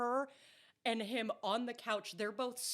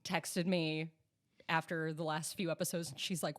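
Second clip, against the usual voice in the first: usual speaking voice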